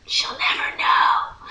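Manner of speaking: slow, secretive whisper; conspiratorial whisper. Retold or imitated. Student plotting to skip summer work, sneaky whisper. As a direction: whisper it slowly, like a secret kept from her.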